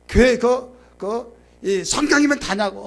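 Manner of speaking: acting out an agitated husband; mock-angry husband challenging his wife; angry retort. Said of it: "막 화가 나면 막 흥분되면".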